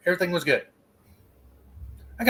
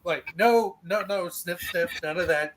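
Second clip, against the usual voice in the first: ordinary talk, nothing marked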